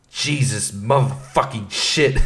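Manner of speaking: slow, drawn-out mock swearing; re-enacted morning outburst; angry cursing. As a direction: curse slowly, spacing out each word. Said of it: "Just angry".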